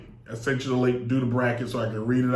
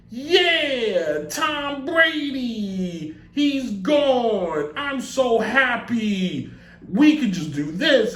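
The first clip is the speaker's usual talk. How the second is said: gleeful rival team; 8 semitones higher, much slower than usual. Retold or imitated. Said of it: "was just like".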